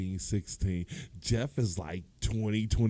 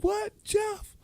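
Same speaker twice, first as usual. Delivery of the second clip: shocked shout; 3 dB louder than usual. Retold or imitated. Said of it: "I was like".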